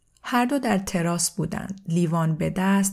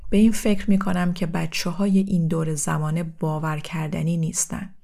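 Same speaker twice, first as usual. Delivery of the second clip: ordinary delivery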